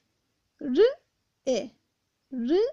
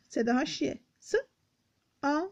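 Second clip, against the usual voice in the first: ordinary talk, nothing marked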